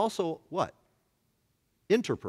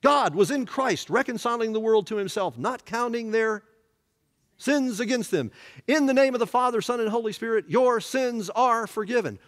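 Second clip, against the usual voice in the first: raised, ringing voice of blessing; pronouncing absolution; 5 semitones higher than usual. Retold or imitated. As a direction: declare it over the whole congregation. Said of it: "I said to you on Sunday".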